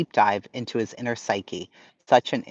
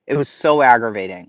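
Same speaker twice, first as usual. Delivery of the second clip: louder, pressed repeat; emphatic; 7 dB louder than usual. Real, not acted. Streamer to chat repeating herself, louder exasperated emphasis. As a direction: say it again with real exasperation.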